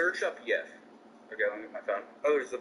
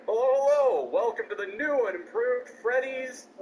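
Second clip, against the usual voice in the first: high, hammed-up game-character welcome; high, showy mock welcome; Banjo-Kazooie voice; 6 semitones higher than usual. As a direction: imitate a cartoony game greeter, pitched up. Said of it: "like, Banjo-Kazooie Undertale voice".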